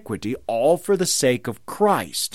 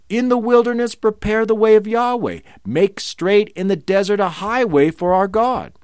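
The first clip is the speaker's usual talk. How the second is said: higher and louder, proclaiming it to the wilderness; crying out the verse; 7 semitones higher, 4 dB louder than usual. Shown as a demonstration. Preacher crying out Isaiah's words, loud and high. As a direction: call it out like the prophet's voice crying. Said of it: "the voice crying out".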